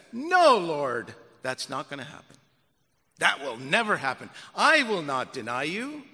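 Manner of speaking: loud, boastful protest; Peter's brash denial. Acted. Peter contradicting Jesus, brash boast. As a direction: brush off the Lord's warning.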